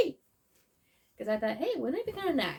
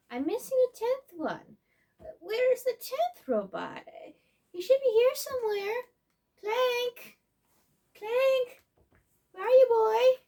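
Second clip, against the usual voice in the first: calling out; 11 semitones higher than usual. Acted. Calling for lost robot.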